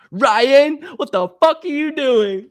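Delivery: high, loud mock mom yell. Mom yelling at her son on the phone, high mock shriek. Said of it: "It's my mom".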